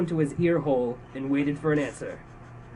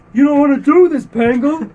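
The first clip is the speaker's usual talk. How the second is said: high, loud, pleading cry; fearful pleading; 11 semitones higher, 14 dB louder than usual. Acted. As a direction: beg for your life, voice shaking. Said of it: "his voice trembling with fear".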